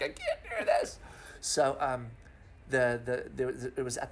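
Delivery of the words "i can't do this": squeaky, very high laughing cry of defeat; half-laughing imitation of being overwhelmed; mock despair. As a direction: throw up your hands in a high comic wail. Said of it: "and I'm like".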